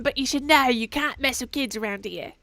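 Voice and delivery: put-on lightly Southern tough kid; mild Southern-accented kid telling the mister off. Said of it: "I forgot I was doing Southern".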